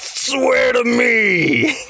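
high, very drawn-out strained shout; Batman. Acted. Every time Batman climaxes, hammed-up shouted impression. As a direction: stretch every word into a climactic roar.

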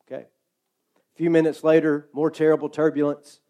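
ordinary talk

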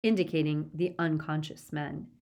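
usual speaking voice

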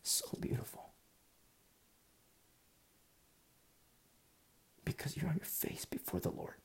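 near-whisper, soft and reverent; hushed awe. Real, not acted. Preacher telling listeners of beauty after tribulation, hushed. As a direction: let it sink to a breath of a whisper. Moved while describing anguished prayer.

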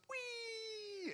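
high, soft little whistle of a weak firework; mock the lame little firework; tiny firework whee. Pastor mimicking a small firework, a high soft whee.

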